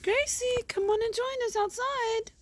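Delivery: high sing-song call to the dog. Real, not acted. Owner calling her dog outside in a high voice. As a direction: coax the dog over in a high voice.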